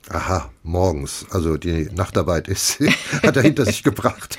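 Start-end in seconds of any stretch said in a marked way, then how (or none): 2.8-4.4 laughing. Real, not acted. Joking about star's night shift.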